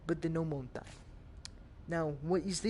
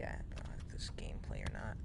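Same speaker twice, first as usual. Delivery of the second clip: whisper; 9 semitones lower, 9 dB quieter, faster than usual. Real, not acted. Quiet aside while playing.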